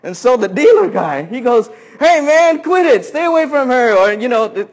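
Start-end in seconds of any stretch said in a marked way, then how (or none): 2.0-4.7 high, loud warning yell. Retold or imitated. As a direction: shout him off the woman. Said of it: "yelling at the guy".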